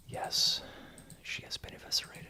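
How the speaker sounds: hushed, villainous whispered aside; gloating whisper. Pretend. Wizard confirms to himself Amy is gone, gloating whisper. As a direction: whisper it to yourself with mock relish.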